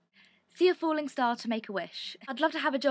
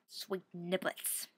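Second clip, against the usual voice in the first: funny low voice; 4 semitones lower, 10 dB quieter, much slower than usual. Pretend. Silly exclamation in odd voice.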